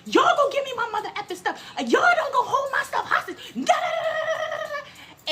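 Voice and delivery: very high, screechy rant into gibberish; hammed-up squealed tantrum; mock screaming. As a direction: imitate a screaming guest making demands. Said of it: "and she's screaming".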